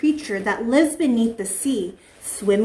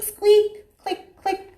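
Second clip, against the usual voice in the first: high squeaky dolphin noises; mimicked dolphin squeaks and clicks; 8 semitones higher, much slower than usual. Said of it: "They go squeak".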